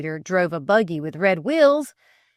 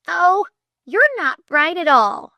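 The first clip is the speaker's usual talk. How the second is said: protesting; 10 semitones higher, 7 dB louder than usual. Acted. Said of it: "protested Flame".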